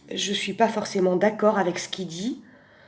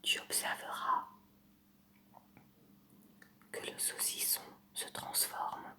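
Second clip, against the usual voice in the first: soft close-mic whisper, right in your ear; ASMR whisper; 14 dB quieter than usual. Pretend. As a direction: breathe every word into the mic like an ASMR video. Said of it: "je vais vous faire une imitation".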